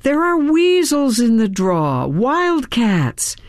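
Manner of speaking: calling out loudly. A narrator's reading. Warning the fox about wildcats, calling out. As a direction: call out a warning without yelling. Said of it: "called out to him in a loud voice".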